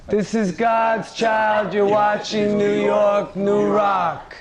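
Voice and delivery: slow shouted Italian accent. Shown as a demonstration. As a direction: sign off in a hammed-up Italian accent. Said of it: "one more time with an Italian accent".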